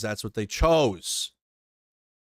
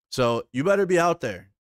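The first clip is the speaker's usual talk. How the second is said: higher and louder demand to show up; emphatic call; 6 semitones higher, 6 dB louder than usual. Real, not acted. Streamer urging Ukraine supporters to protest, louder and higher. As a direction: jab it out like an order to the crowd.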